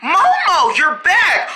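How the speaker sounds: high, joyful cry of welcome; delighted greeting. Acted. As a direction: greet a returning friend excitedly. Greeting Momo's return.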